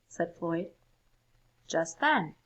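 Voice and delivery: ordinary delivery, nothing marked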